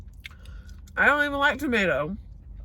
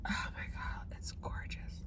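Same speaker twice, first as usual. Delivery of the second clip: breathy awed whisper; 16 dB quieter, slower than usual. Real, not acted. Whispering to the camera over a shake. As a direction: hush it out in awe.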